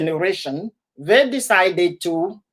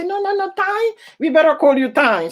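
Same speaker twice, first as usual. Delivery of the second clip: imitating family; 7 semitones higher than usual. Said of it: "They say".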